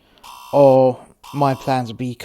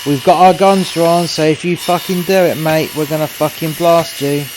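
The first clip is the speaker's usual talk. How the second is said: loud, high, tense warning; armed police warning; 4 semitones higher, 7 dB louder than usual. Retold or imitated. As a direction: warn him with guns drawn. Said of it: "you got the police going".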